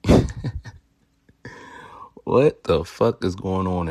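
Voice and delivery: ordinary talk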